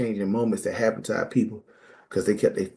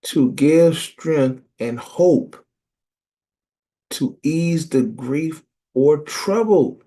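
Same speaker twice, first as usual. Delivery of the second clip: very slow, higher and louder definition; slow weighty reading; 6 semitones higher, 5 dB louder, much slower than usual. Real, not acted. Preacher reading a dictionary definition, slow. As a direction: savor each word of the definition.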